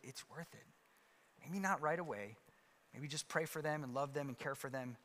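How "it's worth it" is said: quiet. Shown as a demonstration. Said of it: "we can encourage each other and say".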